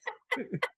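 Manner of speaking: squeaky high burst of laughter; high helpless laugh. Real, not acted. Host cracking up over his own story, high helpless laugh. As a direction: let a high-pitched laugh escape.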